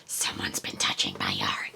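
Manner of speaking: low whispered monster growl. Acted. Mimicking Sammy's monster voice about her yarn. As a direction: play a creepy monster whispering. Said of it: "I'm gonna use monster voice like Sammy".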